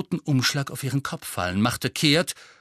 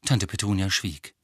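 ordinary delivery

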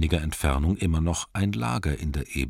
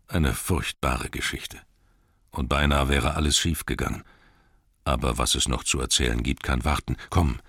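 slightly lowered, measured wizard voice; relieved old wizard. Acted. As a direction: greet a lost friend with understated relief. Relieved Gandalf greets Bilbo.

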